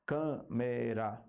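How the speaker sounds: Câmera split slowly into syllables; one emission of sound per syllable. Shown as a demonstration. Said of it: "Cada emissão de som é uma sílaba". (separando as sílabas devagar)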